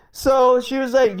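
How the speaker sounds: ordinary talk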